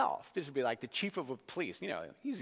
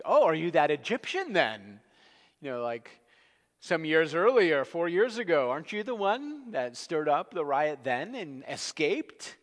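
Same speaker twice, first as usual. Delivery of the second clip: the tribune; 5 semitones higher, 8 dB louder than usual. Retold or imitated. Tribune interrogates Paul in turmoil.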